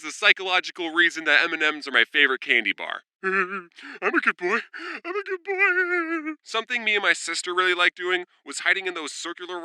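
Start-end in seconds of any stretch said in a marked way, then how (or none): 3.2-4.6 slow, put-on childlike whine; good boy plea. Kid begging mom for candy, slow childish plea. 5.0-6.3 much higher, very slow squeaky plea; pitiful good boy plea. Pretend. Kid begging mom again, high pitiful whine.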